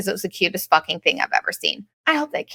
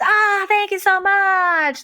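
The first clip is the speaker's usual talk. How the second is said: high, slow, put-on sweet thank-you; mock grateful stranger; 11 semitones higher, much slower than usual. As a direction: ham up a syrupy grateful stranger. Scammer voiced, thanking.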